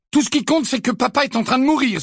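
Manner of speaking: very high, loud anguished cry. A narrator's reading. George cries out to Sirius, high and anguished. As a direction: shout his fear out. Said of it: "s'écria George".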